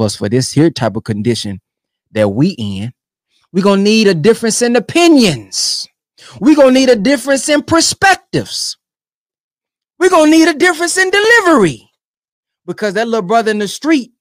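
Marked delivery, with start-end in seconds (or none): 3.5-11.9 rising preacher build; building emphasis. Real, not acted. Preaching build on repeated lines.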